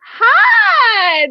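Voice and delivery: high loud squealing hi; excited squeal. Real, not acted. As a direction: squeal a greeting to the guest. Greeting the guest joyfully.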